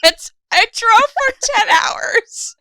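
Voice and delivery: high, drawn-out wailing protest; slap-happy whining complaint; exhausted mock wail. Real, not acted. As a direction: wail it slowly, half laughing. Begging off the intro, overtired.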